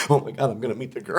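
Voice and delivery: fast, giddy mock fangirl; mock fangirl gush. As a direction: rush the words like a starstruck fan. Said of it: "she was a fucking fangirl".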